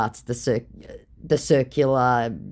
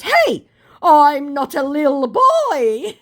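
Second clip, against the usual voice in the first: high, loud, drawn-out whine; hammed-up petulant little boy; mock childish protest; 12 semitones higher, 7 dB louder, slower than usual. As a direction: stretch it out like a sulking child. Scammer's childish protest, acted out.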